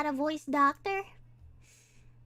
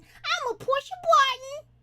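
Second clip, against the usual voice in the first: high, pitched-up silly voice; mock voice-changer squeak; put-on high voice; 7 semitones higher than usual. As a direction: push it up high, rising at the end. Mimics a pitched-up button voice.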